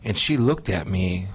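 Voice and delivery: ordinary talk, nothing marked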